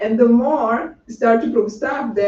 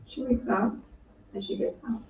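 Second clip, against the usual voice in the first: ordinary talk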